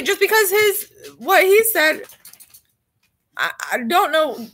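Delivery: normal talking voice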